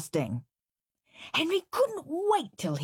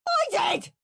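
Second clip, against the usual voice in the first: put-on high shouted kid's retort; screamed. Henry screaming at Peter. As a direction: scream it back at your brother. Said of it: "screamed Henry".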